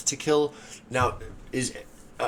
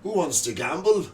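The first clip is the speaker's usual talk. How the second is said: character voice; 9 semitones higher, 4 dB louder than usual. Acted. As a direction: announce it like a cocky gambler. Player speaks as his character Chain.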